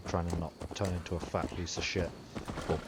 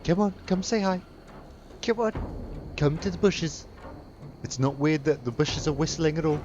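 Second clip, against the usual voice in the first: high coaxing pet-calling voice; 7 semitones higher, 5 dB louder than usual. Acted. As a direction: sweet-talk a dog from the bushes.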